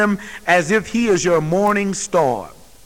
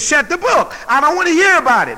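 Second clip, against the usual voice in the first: higher, sharp voiced refusal of a sceptic; mock angry refusal; 8 semitones higher, 4 dB louder than usual. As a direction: play the man slamming the book shut. Unbeliever refuses the Bible.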